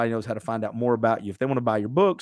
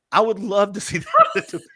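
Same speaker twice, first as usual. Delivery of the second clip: laughing; 7 semitones higher, 5 dB louder than usual. Real, not acted. Laughing at the thought.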